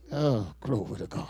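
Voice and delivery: ordinary talk, nothing marked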